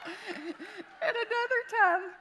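real laughter bursting through the words; high, loud and drawn out with laughter; laughing. Real, not acted. Mom cracks up telling family stories, laughing hard. Laughing after her own anecdote.

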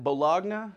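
bologna said as bah-LOG-nuh; spelled-out pronunciation. Shown as a demonstration. Comic explaining a misread word, bah-LOG-nuh. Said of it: "he already said it".

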